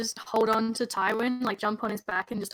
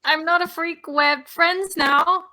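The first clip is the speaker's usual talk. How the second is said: loud, higher-pitched retort, drawn out at the end; acted protest; 7 semitones higher, 7 dB louder, slower than usual. Player to the bystander they grabbed, loud mock protest. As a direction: call it back in mock indignation.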